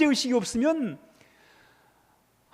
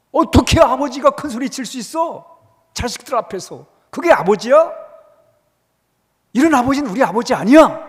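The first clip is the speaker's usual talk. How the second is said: put-on loud, indignant protest of a scolded child; indignant children; 7 dB louder than usual. Scolded children defying their father, loud indignant protest. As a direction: shout it like a child who won't accept blame.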